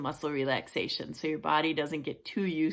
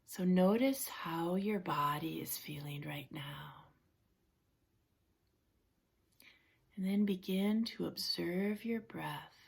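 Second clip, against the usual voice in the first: guided relaxation; 7 dB quieter than usual. Real, not acted. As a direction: guide them gently through each breath with long pauses. Leads a relaxation session.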